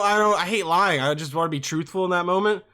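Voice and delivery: normal talking voice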